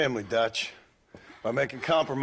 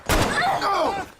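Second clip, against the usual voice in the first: sudden pained yelp, much higher; wounded outburst as the gunshot lands; cry of pain; much slower than usual. Acted. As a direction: hit hard and swearing through it. Shot by Dutch's parting gift.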